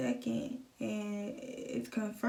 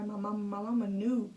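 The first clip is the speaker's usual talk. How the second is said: normal talking voice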